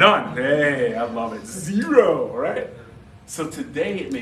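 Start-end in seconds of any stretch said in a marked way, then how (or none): 0.0-2.7 loud cheer. Real, not acted. Celebrating the answer aloud.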